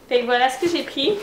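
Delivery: normal talking voice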